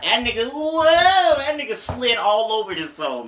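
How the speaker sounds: loud, slow hype; hyped up. Real, not acted. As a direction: lean on it like the verse blew you away. Hyped by the song.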